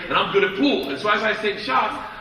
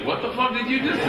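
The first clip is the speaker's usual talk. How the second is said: amused disbelief; 6 semitones higher than usual. Retold or imitated. Gangster's laughing disbelief, acted.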